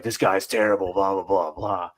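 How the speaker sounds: a light, loud put-on Prison Mike rant; a hint of a Michael Scott-style rant. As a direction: ham up a gruff office-boss character. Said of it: "he was doing Prison Mike from The Office".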